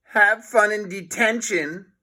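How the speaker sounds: teasing farewell; smug taunt. Acted. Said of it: "grinning smugly".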